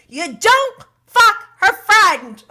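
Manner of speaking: loud, high, slow scolding with every word hammered; stern mom scolding. Retold or imitated. Shayna scolding Sandoval like a mom, loud and slow. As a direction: lecture him like a furious mother. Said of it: "The way Shayna said this was so mom-like".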